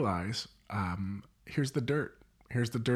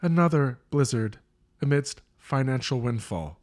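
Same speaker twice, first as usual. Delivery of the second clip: bright, loud newscaster read; news anchor; 4 dB louder than usual. Acted. Mock news broadcast intro.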